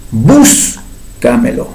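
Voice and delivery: "Búscamelo" slow, with a long "bús", pause, then "camelo"; stressed first syllable. Shown as a demonstration. Teacher giving a second example, "Búscamelo" with stretched "bús". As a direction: hold "bús" apart from the rest of "Búscamelo". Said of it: "cuarta sílaba".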